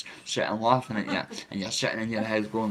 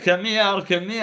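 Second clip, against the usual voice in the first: much higher re-enacted call; urgent calling out; 9 semitones higher, 4 dB louder than usual. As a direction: call out high, as if chasing something. Retold calling to hallucinated tablets.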